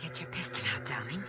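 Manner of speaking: low, hushed aside; secretive murmur to an accomplice; conspiratorial whisper. Acted. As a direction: whisper so the driver won't hear. Secret plotting behind the driver's back.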